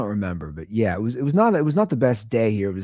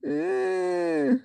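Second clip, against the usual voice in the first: imitating a baby's whine, thin high "eh" sounds; whining baby; 4 dB quieter, much slower than usual. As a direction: whine like a baby wanting to be held. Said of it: "which is more annoying... The baby".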